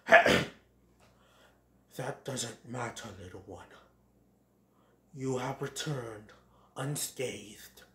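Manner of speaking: low, very soft, solemn voice; gentle Great Prince. Acted. Father forgives his runaway son, low gentle voice. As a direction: forgive him in a low, regal hush.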